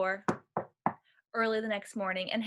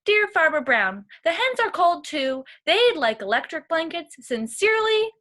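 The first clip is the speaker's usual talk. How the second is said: higher and louder cow voice; cows' letter voice; 5 semitones higher, 5 dB louder than usual. A narrator's reading. Cows writing Farmer Brown a demand, higher and louder. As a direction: read the cows' demand brightly.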